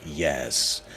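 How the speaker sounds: low, soft and slow gnome; menacing gnome. Acted. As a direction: keep it low and threatening. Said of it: "the gnome's like".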